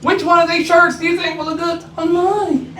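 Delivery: high, flirty customer voice; playful come-on from a fan; flirty woman. Retold or imitated. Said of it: "she's like".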